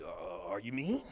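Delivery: dry question back. Acted. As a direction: quietly call her out. Dry lower response.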